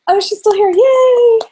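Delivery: high, loud sing-song yay, hammed up; mock cheer. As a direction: squeal a fake happy greeting. Mock cheer for stream viewers.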